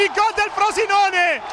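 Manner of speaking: breathless italian goal commentary. Real, not acted. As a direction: bellow gol, stretched out. Goal call repeated.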